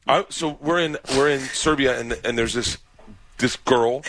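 normal talking voice

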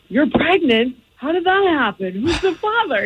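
high-pitched imitation of a yelling dad; retold dad's yell. Daughter imitating Dad yelling from the den, mild high yell. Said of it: "my dad, it, from the den, yells".

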